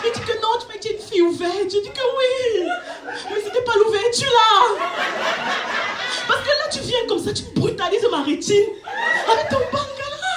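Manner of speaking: hammed-up shocked outrage; outraged scolding. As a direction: scold him in disbelief. Outraged retold scolding of date.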